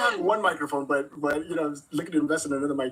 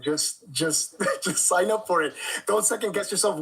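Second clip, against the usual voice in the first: usual speaking voice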